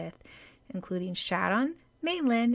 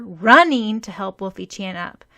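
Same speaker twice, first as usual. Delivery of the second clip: plain reading voice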